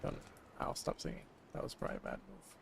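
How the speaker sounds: whispered, fast aside. Real, not acted. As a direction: breathe it as a quick aside. Aside after singing.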